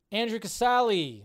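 ordinary delivery

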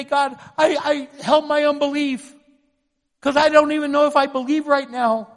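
high, forceful, urgent plea to God; anguished prayer. Acted. Preacher models praying to God through unbelief, anguished cry. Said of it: "I'm praying with anguish".

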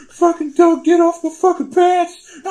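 high, loud blubbering plea; hammed-up tearful shriek; mock crying. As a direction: wail it like a panicked crying kid. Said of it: "fucking crying".

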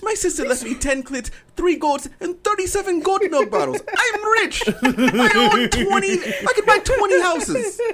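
put-on high, slow, laughing African accent; gloating mock African voice. Riffing in African character.

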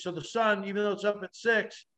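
normal talking voice

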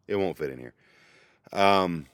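normal talking voice

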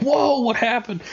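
high startled whoa, hammed up; jolted by an imagined election result; mock surprise. Imagining the news on refresh, mock amazed high whoa. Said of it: "And I'm gonna be like".

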